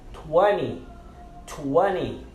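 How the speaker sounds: "twenty" said like "twenny"; silent T. Shown as a demonstration. Teacher models "twenty" for learners, T silent. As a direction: drop the second T of "twenty". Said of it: "nós silenciamos ele".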